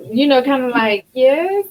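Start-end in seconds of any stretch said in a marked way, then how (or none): 1.1-1.7 meek little yes. Retold or imitated. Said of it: "talking like that".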